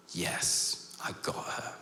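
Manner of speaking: hushed, whispered thought; king's private triumph. Acted. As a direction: whisper it as a secret delight. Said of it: "the king is thinking".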